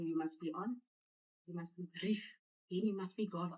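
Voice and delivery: put-on a hushed, lightly done take on the Shoprite meeting accent; imitating the Shoprite boardroom accent in a quiet aside. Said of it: "In that accent".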